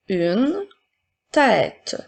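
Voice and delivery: word by word with pauses; careful dictation for pupils to write. Shown as a demonstration. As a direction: dictate slowly, each word set apart. Teacher dictating words to pupils.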